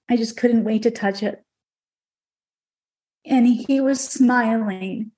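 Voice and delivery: ordinary talk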